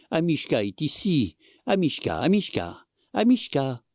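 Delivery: high coaxing calls; calling the dog. A narrator's reading. Old man calls the dog out from under the bed. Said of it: "cria le vieil homme".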